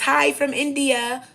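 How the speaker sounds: high, loud, slow mock-sing-song greeting; mocking chat greeting. Mimicking a stranger's chat message to her viewers, loud slow mockery. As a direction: drag out a sneering imitation of a stranger.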